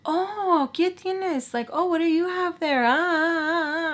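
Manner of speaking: high, teasing sing-out; nosy men, imitated. Men calling to her as she passes with food, high mocking imitation. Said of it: "Now the men are like".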